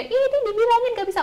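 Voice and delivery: high, loud exasperated scolding; angry mother. Acted. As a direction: act out a mum losing her temper. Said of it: "ibunya nggak marah juga dong ya".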